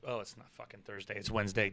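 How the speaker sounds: normal talking voice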